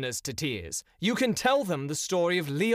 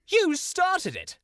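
indignant; slower than usual. Acted. Indignant retort in a quarrel.

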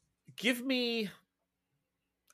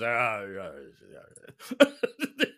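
crowd agreeing; 5 semitones lower, much slower than usual. Acted. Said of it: "Everyone goes".

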